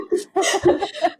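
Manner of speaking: high burst of laughter. Real, not acted. Reacting to joke disclaimer.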